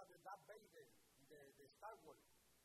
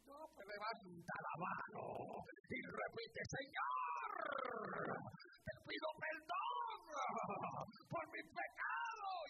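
loud, high, slow dictated prayer; loud leading prayer; 8 semitones higher, 13 dB louder, slower than usual. Retold or imitated. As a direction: lead him through the prayer, loud and deliberate. Pastor leads convert's prayer.

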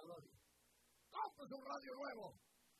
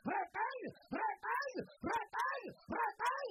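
rising sermon shout; 10 semitones higher, 12 dB louder, much slower than usual. Acted. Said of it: "preacher repeating climax".

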